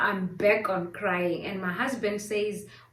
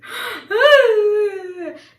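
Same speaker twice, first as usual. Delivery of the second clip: sharp gasp into long high wail; hammed-up loud sob on one note; mock crying wail; 12 semitones higher, 12 dB louder than usual. Re-enacting her tears at the twin news, mock wail. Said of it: "I start crying".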